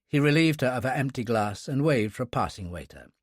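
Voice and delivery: plain reading voice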